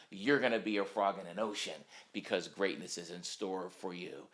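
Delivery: usual speaking voice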